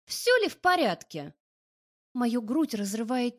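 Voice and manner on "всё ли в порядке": high, loud sarcastic parroting; mocking echo. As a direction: throw his question back mockingly. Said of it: "поддакиваю я".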